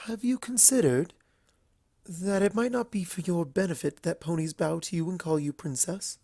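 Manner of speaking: all polite charm, a little nervous; refined Rarity. Acted.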